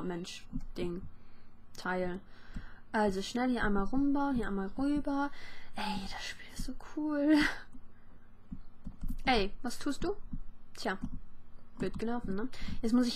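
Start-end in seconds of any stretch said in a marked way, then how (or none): 5.8-7.4 whispered aside. Real, not acted. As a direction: breathe it, unvoiced. Aside while playing. (begeistert geflüstert)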